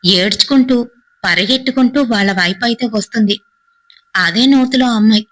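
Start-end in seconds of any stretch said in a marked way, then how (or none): none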